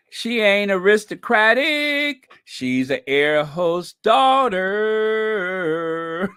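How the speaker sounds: high, loud, slow sing-song recital; mock posh rhyme. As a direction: deliver it as a grand poetic pronouncement.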